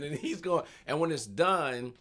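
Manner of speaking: normal talking voice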